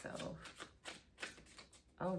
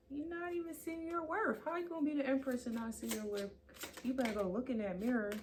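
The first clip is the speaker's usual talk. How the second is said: sassy mock scolding; 6 semitones higher, faster than usual.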